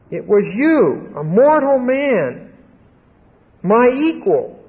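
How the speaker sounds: emphatic "you", pitched high and held; stress on YOU. Acted. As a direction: drive "you" home, word by word. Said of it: "you" is emphatic".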